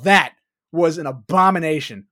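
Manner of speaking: usual speaking voice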